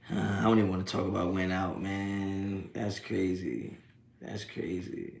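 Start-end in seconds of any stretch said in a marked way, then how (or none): 1.8-5.1 soft, weary aside; quiet disbelief. Real, not acted. Brushing off the bad game, quiet weary aside. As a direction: let it drop, tired.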